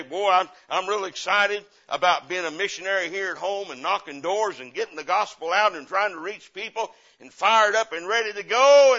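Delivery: loud, high, gushing enthusiasm; mock excited convert. Eager church member about to go door knocking, overexcited and loud. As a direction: gush like a brand-new zealot. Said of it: "When you say".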